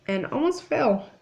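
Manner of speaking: ordinary delivery, nothing marked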